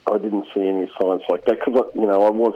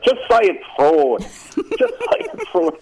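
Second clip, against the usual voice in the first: imitated drunken yelling; 12 semitones higher than usual. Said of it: "intoxicated, yelling out".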